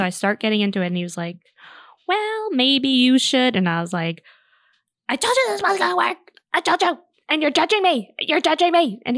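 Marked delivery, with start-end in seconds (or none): 2.1-3.5 slow, raised-pitch imitation of a husband; pointed suggestion. Husband responding to wife's venting, drawn-out and pointed. 5.1-9.0 high-pitched, acted-out marital outburst; upset accusation. Wife retelling her protest to husband, high-pitched accusing.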